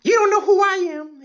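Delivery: high, mocking arrestee voice; shrill arrestee. Comic mimics an arrestee demanding a lawyer, high mocking voice. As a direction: squeal for a lawyer like a spoiled detainee. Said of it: "there's always like".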